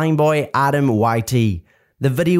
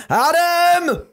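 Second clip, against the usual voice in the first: high, drawn-out angry yell; ear-shredding scream; 12 semitones higher than usual. Acted. Mum screams for Adam from downstairs, high furious yell. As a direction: scream his name up through the house. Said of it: "An ear-shredding scream erupted downstairs".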